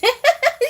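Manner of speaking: quick high giggle; giggling at the chat; high laugh. Real, not acted. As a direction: let a bright laugh burst out. Laughs after greeting.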